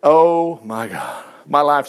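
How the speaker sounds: low, very slow horrified gasp; imagined dread. Pretend. Benjamin realizes the cup is in his sack, slow and low dread. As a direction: sink into Benjamin's panic. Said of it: "You're thinking".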